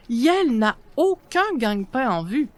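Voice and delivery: usual speaking voice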